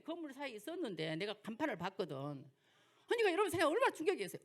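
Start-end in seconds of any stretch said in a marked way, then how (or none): 3.1-4.4 fast, louder, higher burst of shock; quick excited exclamation to the congregation. Real, not acted. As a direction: rush it out, amazed at the memory. Preacher stresses her shock.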